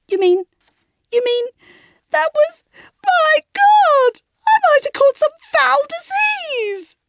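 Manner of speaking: wailing. A narrator's reading. Margot wailing at the table over the foul disease, climbing and tearful. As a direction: climb into a horrified, tearful wail. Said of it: "she wailed".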